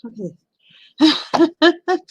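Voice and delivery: slow, high, stretched out in disbelief; drawn-out amazement. Real, not acted. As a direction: stretch it out, half laughing in disbelief.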